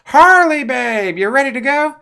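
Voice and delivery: high, loud put-on Joker voice. Joker calling Harley to leave, high put-on villain voice. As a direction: call her like a smug villain boyfriend.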